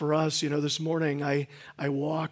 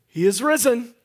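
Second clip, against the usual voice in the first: loud joyful shout to the congregation; shouted call; 7 semitones higher, 9 dB louder than usual. Real, not acted. Pastor leading the Easter call, loud shout. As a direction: call it out big for the crowd. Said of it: "And so I'm gonna do that".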